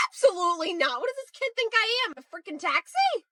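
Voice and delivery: high-pitched, indignant disbelief; high, put-upon griping; exasperated refusal. Acted. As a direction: stretch the last words in outrage. Parent skit, annoyed at pickup request.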